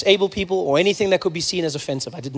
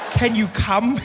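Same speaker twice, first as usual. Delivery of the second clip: put-on higher, over-polite child voice; too-polite little brother; 4 semitones higher, much slower than usual. Mimicking brother's hospital request, high and over-polite. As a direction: mimic a small boy asking very politely. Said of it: "he's too polite for his own good".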